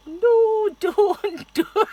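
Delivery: high pleading Scottish voice; mock Scottish protest. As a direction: beg her to stop, in a Scottish accent. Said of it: "She's probably thinking".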